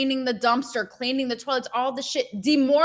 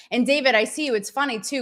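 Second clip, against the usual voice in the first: normal talking voice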